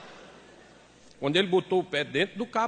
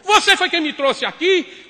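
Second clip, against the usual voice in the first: higher, louder, slow shouted question; angry shout; 10 semitones higher, 8 dB louder than usual. Retold or imitated. Wife to husband, slow angry shouted question. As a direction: fling it back at him.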